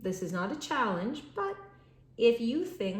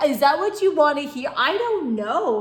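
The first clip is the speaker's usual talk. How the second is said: exasperated; 6 semitones higher, 10 dB louder than usual. Real, not acted.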